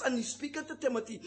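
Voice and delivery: ordinary delivery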